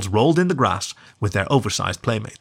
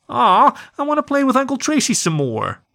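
put-on high, pouting child's whine; a little girl sulking to keep playing, pitched up. A narrator's reading. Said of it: "pouted the birthday girl".

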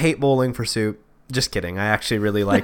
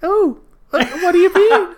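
put-on high falsetto girl voice; 6 dB louder than usual. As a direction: play innocent in a squeaky voice. Man playing the guest Farai.

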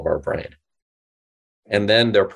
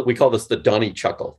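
ordinary talk

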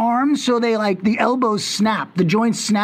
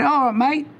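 put-on Australian accent; 4 semitones higher than usual. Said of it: "is mate Commonwealth or Australian".

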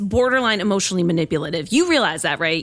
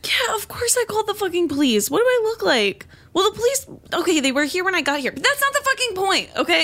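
angry outburst; 9 semitones higher than usual. Acted. Daughter yelling at mom.

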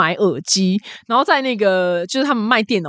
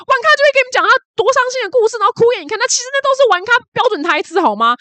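shrill, high mock scolding, rushed in the middle; a big sister tearing into her, pitched way up; 姐姐高声痛骂. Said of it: "他姐就大骂说什么".

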